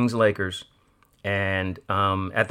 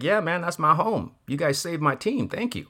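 higher, gushing gratitude to the owners; excited thanks; 7 semitones higher than usual. Retold or imitated. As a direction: thank them like they saved your team.

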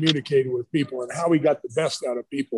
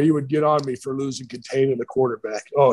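ordinary talk, nothing marked

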